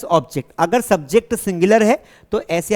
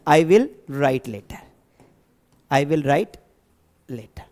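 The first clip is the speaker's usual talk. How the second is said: slow dictation; 6 semitones lower, much slower than usual. Shown as a demonstration. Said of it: "मैं पत्र लिखूंगा".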